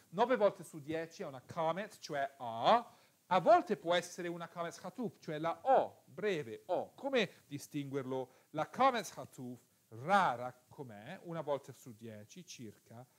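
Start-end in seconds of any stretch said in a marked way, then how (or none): none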